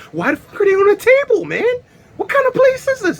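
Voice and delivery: high, loud mock panic; panicked. Guy freaking out about shrooms on the table, high panic. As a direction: shriek it in a hammed-up freakout.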